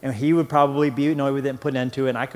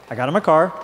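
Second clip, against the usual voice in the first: normal talking voice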